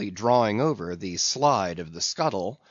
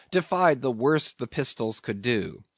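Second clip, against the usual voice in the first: ordinary delivery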